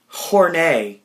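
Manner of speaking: HORNAY with a long "ay" ending; French-style name. Retold or imitated. Woman introducing herself to Garth, French-style Hornay. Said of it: "It's French".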